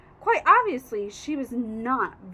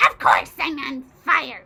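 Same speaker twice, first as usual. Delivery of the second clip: louder cranky yell; 9 dB louder than usual. A narrator's reading. Grandma yells back at the boy, loud. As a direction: snap back loudly. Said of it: "she yelled".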